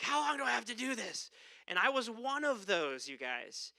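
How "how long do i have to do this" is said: weary complaint. Retold or imitated. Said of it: "You're like".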